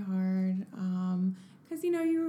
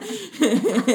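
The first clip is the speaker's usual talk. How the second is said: loud laugh; 7 semitones higher, 9 dB louder than usual. Real, not acted. Laughs at own story.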